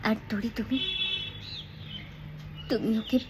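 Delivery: hushed, with long pauses before ভুল; soft acted line. Shown as a demonstration. Actress gently tells Tori she misunderstands him, soft acted delivery. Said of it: "এই ভুলের মধ্যেও কিন্তু অভিনয় করা যায়".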